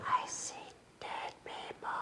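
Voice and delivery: eerie whispered movie line. Pretend. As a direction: breathe it out like a ghost story. Spooky movie quote in dark.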